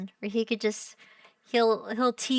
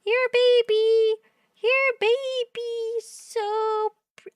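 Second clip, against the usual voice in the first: gushing coo; 7 semitones higher, slower than usual. Real, not acted. Streamer gushing over a family baby photo, high cooing.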